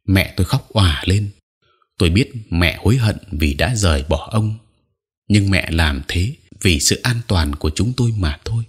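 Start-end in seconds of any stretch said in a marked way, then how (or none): none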